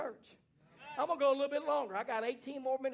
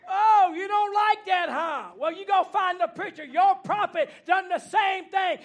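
loud, slow preacher's shout, much higher; imitating the prophet's shout; 8 semitones higher, 13 dB louder, slower than usual. Preacher mimics the prophet scolding the church, loud shout. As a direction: belt it like the prophet in full cry. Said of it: "I recall the prophet of God doing this".

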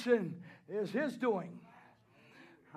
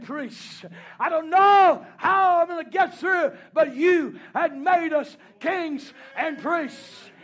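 preaching at full force; 5 semitones higher, 8 dB louder than usual. Acted. Preacher to the congregation, swelling into a shouted refrain. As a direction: build the refrain louder and higher, driving it home.

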